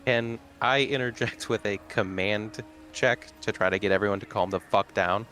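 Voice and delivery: ordinary talk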